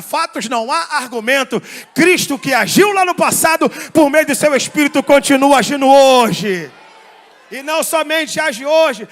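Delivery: roaring pulpit climax; full-force preaching. Real, not acted. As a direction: thunder it out to the whole congregation.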